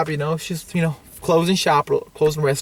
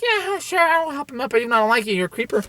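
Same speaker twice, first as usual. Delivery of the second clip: put-on grudging girl; 6 semitones higher, faster than usual. Girl grudgingly helping the creeper up, high imitated voice. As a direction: imitate a girl reluctantly agreeing. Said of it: "The girl's like".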